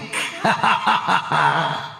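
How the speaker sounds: rolling villainous chuckle; taunting laugh. Acted. Villain urging prey to be quick, taunting laugh. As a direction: laugh at your fleeing prey.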